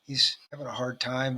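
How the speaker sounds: ordinary talk, nothing marked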